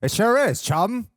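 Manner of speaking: put-on loud, high old-time film voice; old-time movie voice. Shown as a demonstration. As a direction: bark it like a forties movie. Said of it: "that old time sound".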